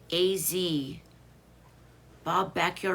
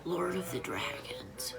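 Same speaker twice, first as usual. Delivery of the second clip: hushed read-out; 5 semitones lower, 7 dB quieter, slower than usual. Real, not acted. Reading a viewer's comment aloud.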